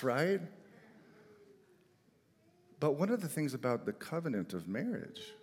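ordinary talk, nothing marked

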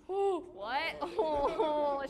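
laughing mock gasp. Real, not acted. Preacher reacting to a cheeky answer with laughing mock shock. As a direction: act scandalized, laughing.

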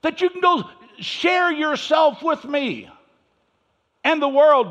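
high, loud, slow sermon shout; preaching shout. Acted. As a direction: cry it out to the church. Preaching climax.

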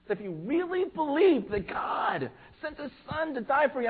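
fervent pulpit appeal; impassioned preaching. Acted. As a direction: press the congregation with urgency.

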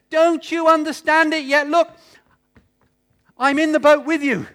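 loud, high emphatic plea as Jesus; Jesus urging. Acted. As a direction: urge the disciples loudly. Preacher voicing Jesus, emphatic.

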